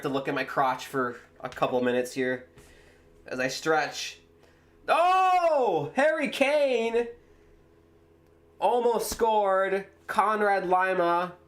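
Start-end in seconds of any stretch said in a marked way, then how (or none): 4.9-11.3 much higher, slow drawn-out cry of the name; real excitement as the header goes close; excited near-miss call. Real, not acted.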